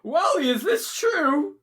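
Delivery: high, crying and laughing despair; wailing mad king. Acted. The mad king wails to Wally about his badgers, high and crying. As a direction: wail over your lost badgers.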